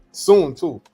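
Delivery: normal talking voice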